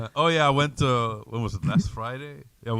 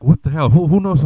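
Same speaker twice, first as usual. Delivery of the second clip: loud, baffled retold reaction; bewildered; 3 semitones higher, 10 dB louder than usual. Guest retelling a mystery phone call, bewildered. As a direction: raise the voice in mock bewilderment.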